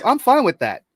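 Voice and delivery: high, loud easygoing manager voice; high mock manager. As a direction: play the boss shrugging it off. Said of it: "And he's like".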